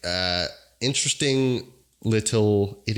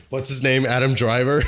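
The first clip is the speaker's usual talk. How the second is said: usual speaking voice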